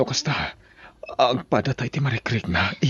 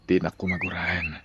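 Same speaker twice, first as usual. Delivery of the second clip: whispered confession; 3 semitones lower, 7 dB quieter than usual. Acted. Man whispering a confession to his brother-in-law. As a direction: whisper it under the breath.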